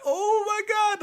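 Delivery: high squeak of panic; panicked high pitched. Retold or imitated. Panic rising.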